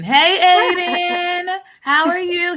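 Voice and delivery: high, loud, slow sing-song hello; sing-song greeting. Real, not acted. Greeting young nephew in chat.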